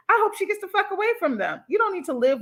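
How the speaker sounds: high, fast and fierce; fierce wish. Real, not acted. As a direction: rush it out angrily. Angry wish for daughter.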